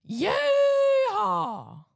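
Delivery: high shouted yeehaw. Acted. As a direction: whoop it out like a cowboy. Calls yeehaw for the intro.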